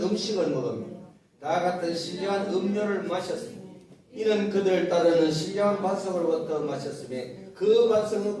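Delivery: loud congregational Bible recitation; formal, projected pulpit reading; scripture reading. Acted. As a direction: read the passage out firmly, in measured phrases.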